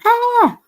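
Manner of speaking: sudden high yelled "Ah!" burst; retold scream. Telling viewers about a name change, high shriek. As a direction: let out a short high shriek. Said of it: "I'm gonna scream".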